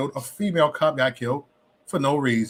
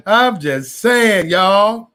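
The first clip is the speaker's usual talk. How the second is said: very slow, higher and louder; slow, raised-voice "just saying"; drawn-out disclaimer; 4 semitones higher, 5 dB louder, much slower than usual. Real, not acted. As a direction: stretch out every word after a laugh. Defends self after laughing.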